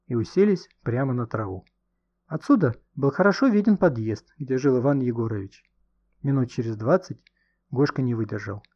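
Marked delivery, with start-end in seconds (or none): none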